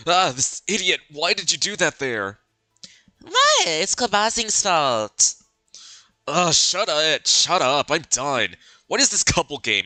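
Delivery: put-on bickering girl. Girl snapping at the boy she is paired with, flustered. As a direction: scold him like an embarrassed teen. Voices bickering game characters.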